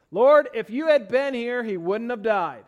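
slow, loud blaming plea; Mary blaming Jesus, slow and loud; blaming rebuke. Acted. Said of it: "This kind of blaming rebuke".

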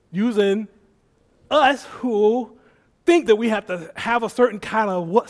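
ordinary talk

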